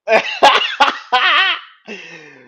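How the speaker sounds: loud, high burst of laughter; real helpless cackle; big laugh. Real, not acted. Cracks up at the retold line.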